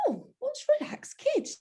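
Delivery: high squeaky surprised oh; surprised question. Retold or imitated. Telling a friend how she first asked, mock surprised. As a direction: chirp it up high like you'd never heard of it.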